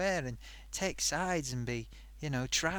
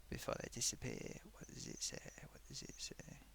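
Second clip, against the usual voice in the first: barely voiced, very soft murmur; muttering to oneself; 14 dB quieter than usual. Real, not acted. Host squinting at disappearing comments, muttering under the breath. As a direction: trail down to a whispery self-question. Reads screen comments to himself.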